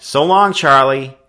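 called out loud and high; calling out. A narrator's reading. Boy calling goodbye to friends, high and loud. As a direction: call it across the street to a friend. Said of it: "he called as he turned his wheel".

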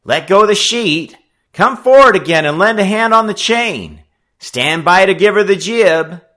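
high, loud sailor's orders; commanding. A narrator's reading. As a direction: take charge of the boat out loud. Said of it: "Frisco Kid, the sailor, strong and dominant".

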